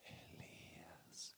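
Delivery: slow, voiceless whisper. Acted. God's voice whispering Elijah's name. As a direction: breathe the name like a secret. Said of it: "Dios le habló a Elías en un".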